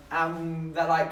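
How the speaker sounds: ordinary delivery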